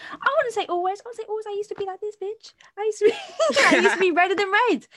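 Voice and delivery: high, loud, fast laughing boast; excited joking boast. Real, not acted. Friend to coworkers, a high laughing boast.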